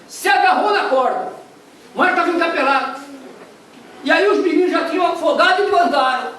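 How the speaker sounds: high, loud preaching at full force; fiery preaching. Acted. Preacher tells the congregation the shipwreck story, fiery and loud. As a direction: storm the story out from the pulpit.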